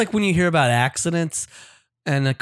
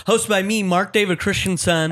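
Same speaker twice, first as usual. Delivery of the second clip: normal talking voice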